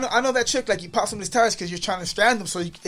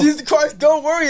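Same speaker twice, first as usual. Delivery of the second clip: blessing; 10 semitones higher, 9 dB louder than usual. Retold or imitated. High loud blessing.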